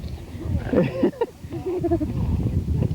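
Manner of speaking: a high burst of laughter; big high laugh. Real, not acted. Host laughing as the kid spits on camera.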